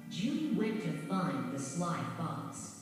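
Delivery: ordinary delivery, nothing marked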